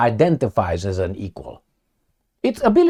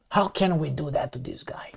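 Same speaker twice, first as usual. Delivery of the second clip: whispered scheming aside; mock whisper; 11 dB quieter than usual. West scheming about Russia, mock whisper. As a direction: hush it like a plotting colonizer.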